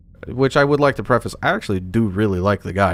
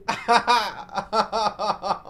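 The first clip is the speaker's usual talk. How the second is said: burst of laughter; 11 semitones higher, 4 dB quieter than usual. Real, not acted. Laughs at result.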